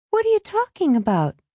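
put-on very high-pitched girl's question; questioning sister. A narrator's reading. Alice asks Benji what he means, sharply questioning. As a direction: challenge him in a high voice.

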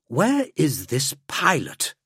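slow, pointed question; impatient demand. Acted. Drake losing patience with his alien guide, slow and impatient. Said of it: "quickly losing patience with Grek".

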